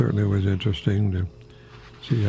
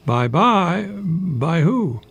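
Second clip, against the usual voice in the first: playful wordplay; 6 semitones higher, much slower than usual. Shown as a demonstration. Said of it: "is that B Y E".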